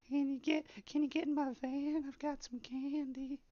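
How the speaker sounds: a sleazy stranger offering candy; creepy old man voice. Shown as a demonstration. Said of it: "it needs a little bit of work".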